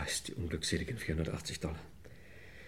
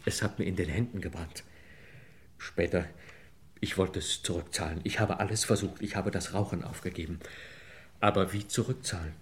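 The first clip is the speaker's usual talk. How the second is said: ordinary delivery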